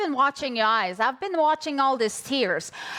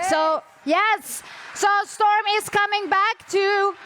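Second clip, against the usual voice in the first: host announces next session; hyped host; 8 semitones higher than usual. Real, not acted. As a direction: keep the crowd buzzing.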